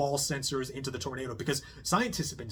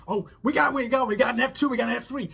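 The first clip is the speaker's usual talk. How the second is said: much higher and louder, thrilled calling out; excited storm-chaser shout; 9 semitones higher, 7 dB louder, faster than usual. Retold or imitated. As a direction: yell it like you're chasing the tornado. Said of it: "they're like".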